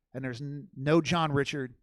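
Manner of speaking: ordinary talk, nothing marked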